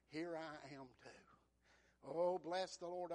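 ordinary delivery

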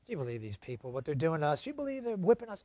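low, soft mock grumbling; grumbled complaining. Israelites complaining about their taskmasters, grumbled complaining. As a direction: grumble among yourselves, under your voice. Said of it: "they did a lot of complaining".

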